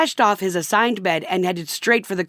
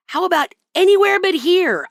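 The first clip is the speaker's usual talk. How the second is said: high, louder sharp retort; fired back; 11 semitones higher, 4 dB louder than usual. Retold or imitated. Wife fires back at her husband, sharp and louder. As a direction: snap it back at him. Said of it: "I fired back".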